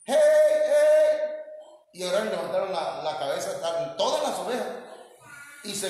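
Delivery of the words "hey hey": very loud, high, sung-out call; long ringing shepherd's yell; shepherd's shout. Retold or imitated. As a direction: bellow to the flock like the real shepherd. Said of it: "le levanta la voz y empieza a gritar".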